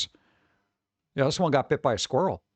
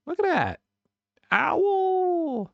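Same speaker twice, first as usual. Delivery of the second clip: cooing; much slower than usual. Real, not acted. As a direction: coo at the tiny owl. Cooing at baby owl picture.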